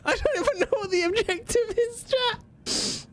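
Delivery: giggling way up high; laughing high squeak. Real, not acted. Streamer laughing to chat at a confusing objective.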